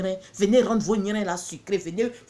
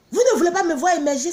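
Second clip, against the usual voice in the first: louder, higher, fast indignant challenge; indignant outburst; 7 semitones higher, 7 dB louder, faster than usual. Real, not acted. Seller scolding viewers who won't buy, indignant. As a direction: throw the accusation at them. Scolding audience about buying.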